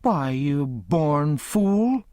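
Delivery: slow, drawn-out contemptuous scold; scornful aunt. A narrator's reading. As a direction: drag the insult out in disgust. Aunt Sally insulting Tom.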